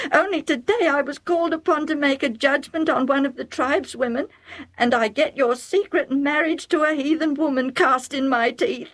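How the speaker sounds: shocked mother scolding her son; Rebecca blurting out. A narrator's reading. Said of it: "Rebecca blurted out".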